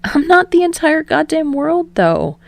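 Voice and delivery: lightly voiced high young man protesting; high protesting Kirishima. A narrator's reading. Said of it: "Kirishima protests".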